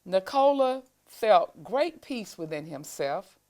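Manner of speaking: usual speaking voice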